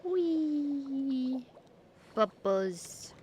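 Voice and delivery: usual speaking voice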